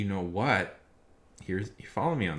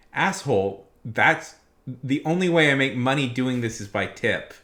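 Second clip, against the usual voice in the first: loud, higher, indignant comeback; angry retort; 4 semitones higher, 7 dB louder than usual. Retold or imitated. Gig worker answering a customer who won't tip, loudly indignant. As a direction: snap back at the cheap customer, loudly.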